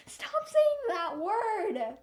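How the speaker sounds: loud, high, drawn-out wail; exasperated outburst. Real, not acted. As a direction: stretch every word in comic despair. Exasperated outburst at repetitive character.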